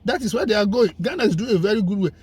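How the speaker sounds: usual speaking voice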